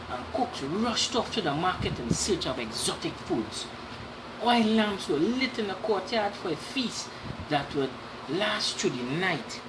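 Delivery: usual speaking voice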